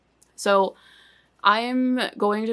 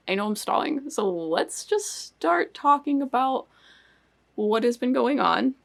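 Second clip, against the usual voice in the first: ordinary talk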